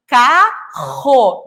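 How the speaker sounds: "carro" slowly, with a long throaty R; strong R. Shown as a demonstration. Teacher showing the double R, slow guttural R. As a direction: split "carro" into ca and a guttural rro. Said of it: "dois R's ali".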